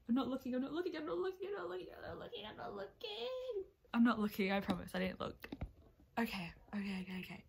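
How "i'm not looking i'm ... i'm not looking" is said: rising higher and softer with each repeat; nervous avoidance. Real, not acted. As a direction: panicky chant climbing to a small high squeal. Nervously avoiding the results page.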